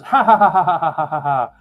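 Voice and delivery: high, loud stagey ha-ha-ha that tails off; acted group laughter. Game master voicing the amused leprechauns, stagey laughter. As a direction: fake a hearty laugh, dropping in pitch. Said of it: "They start laughing again".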